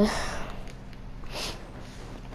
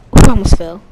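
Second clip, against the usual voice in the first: startled yelp; 15 dB louder than usual. Real, not acted. Near fall in game.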